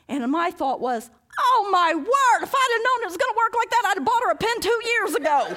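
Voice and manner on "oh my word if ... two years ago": much higher and louder, exclaimed; high, loud astonished outburst; amazed exclamation. Retold or imitated. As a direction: burst out in disbelief. Exclaiming realization.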